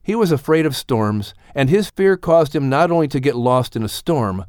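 ordinary delivery

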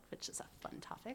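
hushed, breathy aside; whispering. Acted. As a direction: whisper it like a secret. Spooky topic whispered.

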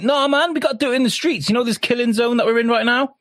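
high, eager mock leader; mocking that guy. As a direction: sell the doomed plan high and cheerfully. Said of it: "that guy was like".

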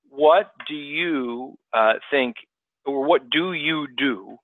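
stretched slow phrasing; slow each word down. Real, not acted. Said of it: "try to talk slower".

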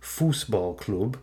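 Fußballclub said slowly as German FOOS-bahl-kloob; German pronunciation. Shown as a demonstration. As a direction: say Fußballclub in German with long oo vowels. Gives the German name of club.

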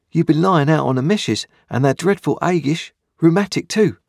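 put-on a small boy speaking up to a convict; young boy Pip. A narrator's reading. Boy Pip's voice in narration.

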